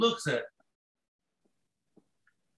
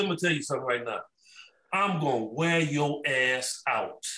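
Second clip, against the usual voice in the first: retold menacing threat; 5 semitones lower than usual. Bird's threat to the rookie, acted.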